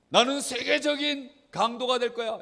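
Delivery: high, loud boast of a would-be robber; child's mock vow. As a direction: proclaim the bad dream with gusto. Child's bad resolve acted out. (높고 크게 당차게 선언하듯)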